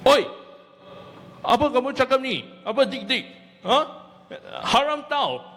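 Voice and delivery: fast, high, indignant Malay challenge; hammed-up offended stranger confronting him; angry Malay guy. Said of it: "one Malay guy suddenly come in".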